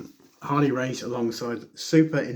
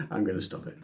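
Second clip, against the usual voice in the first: ordinary talk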